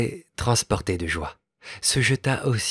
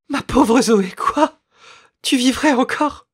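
much higher, louder, frantic cry of hope; delirious hope; 9 dB louder, slower than usual. A narrator's reading. A mother hears her lost child may live, frantic high cry. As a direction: cry out as if she can't believe it. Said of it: "dans un délire d'espérance".

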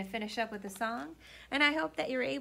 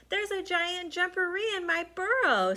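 scared rabbit; 9 semitones higher, 6 dB louder than usual. Acted.

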